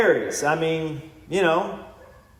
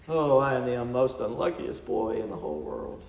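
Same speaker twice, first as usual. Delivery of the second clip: a lightly voiced whining kid, a bit softer; whiny hiney; 4 semitones lower, 6 dB quieter than usual. Preacher mocking a complaining believer, mild whiny kid voice. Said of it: "now you're just being a whiny hiney".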